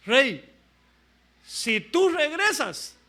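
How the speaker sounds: loud, high, drawn-out challenge; defiant prophet. Retold or imitated. Prophet challenges the departing king, loud and slow. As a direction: call it out slowly as an acted prophet. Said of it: "qué dice el profeta".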